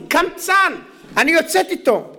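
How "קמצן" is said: higher outraged cry. Acted. Wife complains about stingy husband.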